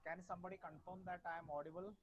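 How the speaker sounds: very faint, far-off voice; barely audible. Real, not acted. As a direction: speak barely above a murmur. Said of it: "Sir, your voice is very low".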